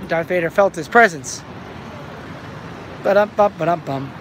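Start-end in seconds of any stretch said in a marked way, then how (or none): 3.0-4.1 imitated drum sting, "ba-dum-bum"; mock rimshot. Joker capping his own Darth Vader joke, mock rimshot. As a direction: voice a comedy rimshot.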